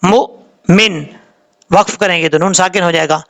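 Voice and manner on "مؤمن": مؤمن in two pieces, glottal catch between; with a jerk. Shown as a demonstration. Teacher demonstrating hamza sakin, jerked مؤمن. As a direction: clip the mu in مؤمن, hold the stop, then min. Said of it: "جھٹکے کے ساتھ پڑھا جائے گا".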